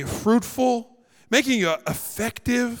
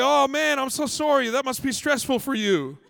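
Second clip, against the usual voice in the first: higher-pitched mock-sorry sympathy; over-polite apology; 6 semitones higher than usual. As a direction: pour on insincere Canadian politeness. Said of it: "We're polite but mean".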